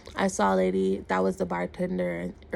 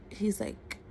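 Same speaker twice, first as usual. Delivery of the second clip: ordinary delivery, nothing marked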